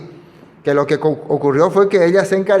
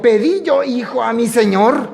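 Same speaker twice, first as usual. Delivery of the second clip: the line "Pedí yo hijo a mi señor" in a mock foreign accent, higher; sing-song put-on accent, rising at the end; the woman's words in a hammed-up accent; 6 semitones higher than usual. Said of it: "No sé si con ese acento dijo".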